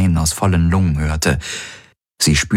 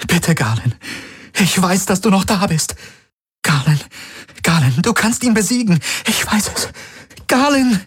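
much higher, desperate pleading; girl begging her possessed friend to resist. A narrator's reading. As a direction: beg him to fight back. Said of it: "Sie sah ihn flehend an".

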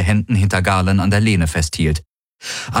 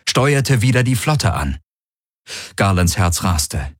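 usual speaking voice

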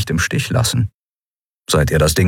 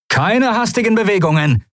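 higher, called-out warning; calling out a threat; 14 semitones higher than usual. A narrator's reading. Sephiron to the guards, higher called-out warning. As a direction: hold them back with the blade. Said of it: "rief er den Weißmänteln zu".

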